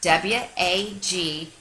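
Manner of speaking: slow letter-by-letter E M M A; spelling out letters. Shown as a demonstration. Dog spelling a new friend's name, slow letters. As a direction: space each letter out. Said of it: "he spelled".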